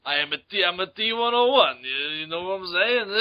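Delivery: slurred, dopey action-star voice; Sylvester Stallone. Acted. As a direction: ramble like a punchy boxer. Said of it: "Terminator Two starring Sylvester Stallone".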